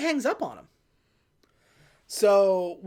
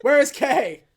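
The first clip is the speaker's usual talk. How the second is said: loud, higher mock demand; acted-out yelling for an answer; retold yell; 5 semitones higher, 7 dB louder than usual. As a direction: burst out with an exasperated question. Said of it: "What are you yelling about?".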